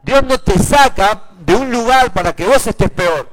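high, loud pulpit proclamation; full-force preaching. Real, not acted. Preacher promising God leads to better places, full-force preaching. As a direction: drive each phrase home to the congregation.

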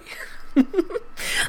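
high breathy mock witch laugh; witchy cackle. Host ending the ghost story with a mock witch cackle. Laugh after spooky bit.

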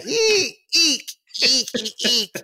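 high squeaky panicked shrieks; rubbery high squeaky toy. Retold or imitated. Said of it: "my gut reaction ... is like".

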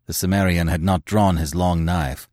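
ordinary delivery

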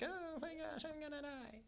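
tiny, very high, soft wail; mock despair. Shown as a demonstration. Preacher showing a volunteer Elijah under the tree, mock despair. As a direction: play-act helpless doom in a thin high voice. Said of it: "Act like you're".

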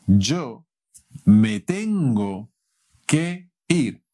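slow, deliberate Spanish; careful classroom delivery; slow teaching demo. Shown as a demonstration. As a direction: spell it out slowly for learners. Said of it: "puedes decir".

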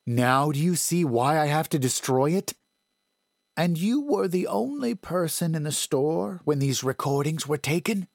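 a hint of an older woman, questioning; woman's voice. A narrator's reading.

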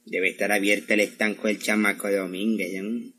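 low, soft scheming to himself; muttered aside. Acted. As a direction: mutter it out of mother's hearing. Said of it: "¿Cómo dijiste, mijo?". (hablando bajito para sí)

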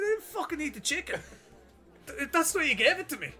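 high-pitched, indignant mock yell; angry retort. Customer insists to the worker he was given it, high indignant mock yell. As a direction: throw it back at him, voice up high. Retold argument at chipper counter.

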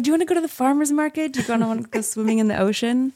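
quick, high, bubbly questions; eager invitation. Retold or imitated. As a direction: rattle off plans, eager to see him. Excited invitations to new crush.

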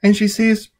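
ordinary delivery, nothing marked

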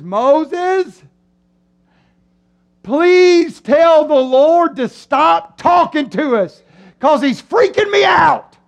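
high, loud, drawn-out begging to Moses; freaked-out plea. Acted. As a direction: shout a hammed-up frantic plea. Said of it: "They run to Moses and they say".

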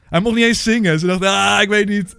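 high, loud comic protest; mock exasperation. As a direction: act out flustered indecision at full tilt. Mock outrage, acts makers' panicked thought.

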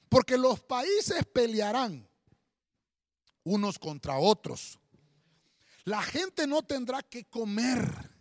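loud, slow proclamation with weighty pauses; forceful scripture reading. Acted. Preacher reads the prophecy to the congregation, loud solemn proclamation. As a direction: proclaim each prophecy slowly and loudly.

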